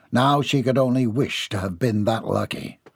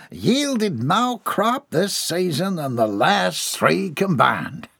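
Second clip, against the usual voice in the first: slow, higher drawling farmer; country farmer voice; 6 semitones higher, slower than usual. Acted. The farmer boasts of his crop in a slow country voice. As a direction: boast about the harvest like a country man.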